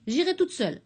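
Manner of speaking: petite fille butée, nettement plus aiguë; lance-le en défi d'enfant; fillette têtue. A narrator's reading. Fillette têtue défie ses amies. (la fillette défie ses amies, voix aiguë et têtue)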